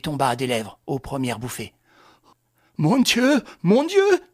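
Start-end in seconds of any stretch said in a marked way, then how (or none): 2.8-4.2 frantic wail, much higher and louder; rising distress. Acted. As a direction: despair spilling over. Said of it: "Sa détresse redoubla".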